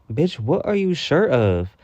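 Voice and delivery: high, stretched-out incredulous demand; fed-up, drawn-out challenge; exasperated. Retold or imitated. Viewer talking back to an evasive documentary interviewee, exasperated.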